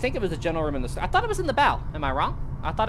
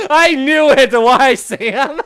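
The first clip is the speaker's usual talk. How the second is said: laughing outcry; 10 semitones higher, 13 dB louder, much slower than usual. Real, not acted. Rickrolled, laughing at friend.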